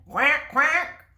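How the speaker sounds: high, loud quack quack; duck quack. Shown as a demonstration. Reading to children, repeats the puppy's high quack. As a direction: quack it again for the kids. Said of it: "Listen again".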